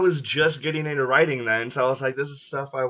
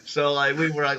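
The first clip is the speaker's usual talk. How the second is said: usual speaking voice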